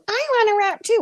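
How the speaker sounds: high-pitched mock pleading; put-on high comic voice; mocking comedy voice. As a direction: mimic a silly, eager plea up high. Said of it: "you came with the comedy shit".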